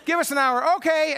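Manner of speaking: cheerful answer. Retold or imitated. Dad telling the neighbor kids it's family time, high and cheerful. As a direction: play both sides brightly, laughing. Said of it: "And I said".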